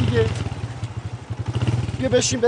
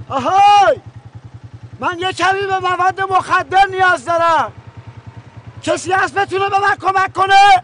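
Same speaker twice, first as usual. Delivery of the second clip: high, loud call to the whole street; shouting out; 8 semitones higher, 5 dB louder than usual. Acted. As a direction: holler slowly and loudly for anyone to hear. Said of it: "یه داد بزنم میام". (داد زدن بلند وسط خیابون)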